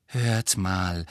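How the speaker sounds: ordinary delivery, nothing marked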